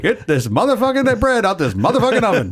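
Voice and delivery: high, loud, laughing angry voice; Samuel L. Jackson. Pretend. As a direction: bark the recipe like a raging hitman. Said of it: "Why is he so angry?".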